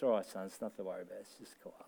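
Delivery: hushed, fast, fading into a whisper; calm the scared son quietly by the fire; dad's soft reassurance. Retold or imitated. Dad calming scared boy at night.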